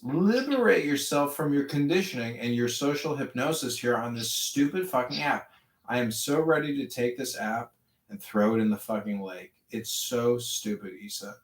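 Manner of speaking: normal talking voice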